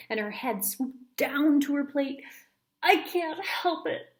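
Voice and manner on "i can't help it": lightly voiced girl pleading, slow; a hint of a helpless kid; mock helpless kid. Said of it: "she said, licking".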